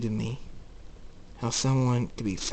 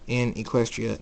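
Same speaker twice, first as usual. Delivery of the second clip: ordinary delivery, nothing marked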